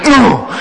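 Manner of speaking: high, loud groaning "Oh!"; mock schoolgirl groan; pupil's groan. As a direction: groan too soon like the bored pupil. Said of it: "She went".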